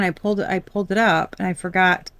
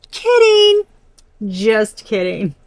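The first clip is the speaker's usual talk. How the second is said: mock two-faced kidding; 6 dB louder, slower than usual. Reading a fake friend who drops the act, mocking sing-song. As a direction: toss off the mask with a mocking just kidding. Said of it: "And then they're like".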